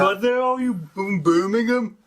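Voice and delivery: sing-song Brummie greeting; Birmingham accent. Shown as a demonstration. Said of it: "like the, the Birmingham accent".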